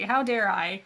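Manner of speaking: plain reading voice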